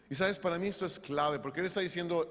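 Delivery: usual speaking voice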